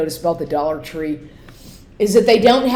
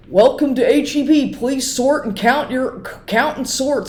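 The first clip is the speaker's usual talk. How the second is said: much higher, mock announcer voice; weird store announcement; 7 semitones higher than usual. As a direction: ham up the odd loudspeaker message. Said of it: "another weird announcement that they have".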